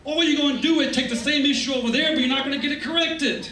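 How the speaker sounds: normal talking voice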